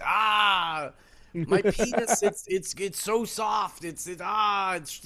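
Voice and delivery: high, loud, hammed-up wailing; mock anguish. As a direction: wail in exaggerated fake distress. Mock horrified wailing, parodying men.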